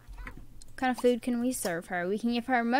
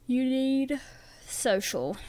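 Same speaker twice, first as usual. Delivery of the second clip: talking through a yawn; words stretched by a yawn; much slower than usual. Real, not acted. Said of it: "Sorry, I was yawning".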